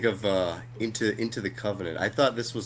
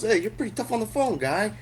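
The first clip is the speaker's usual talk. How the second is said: higher taunting voice, sneering at the caller; mocking tough guy; 10 semitones higher than usual. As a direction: taunt him like a bully who found him. Retelling the skit's tough guy.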